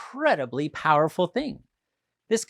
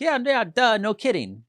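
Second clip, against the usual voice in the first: higher, louder mocking shrug-off; dismissive duh; 7 semitones higher, 5 dB louder than usual. Our inner voice shrugging it off, mock dismissive. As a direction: toss it off like it's obvious. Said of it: "we're like".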